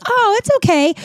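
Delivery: high-pitched put-on reassurance; mock her breezy okay, way up high; imitated oblivious woman. Acquaintance staying to chat in the bathroom, mock-breezy. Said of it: "This Looney Tune was like".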